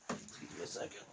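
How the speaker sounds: whisper. Real, not acted. Aside while leaving to close door.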